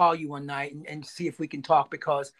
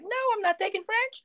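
high, sheepish denial; admitting the lie to his friend, high and sheepish; high squeak. Retold or imitated. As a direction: squeak out the confession. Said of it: "And I was like".

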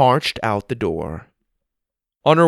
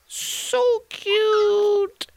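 cooing at kitten. Acted.

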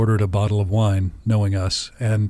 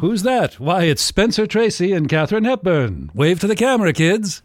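put-on mid-Atlantic accent; 7 semitones higher than usual. Newsreel voice continues, Hollywood stars.

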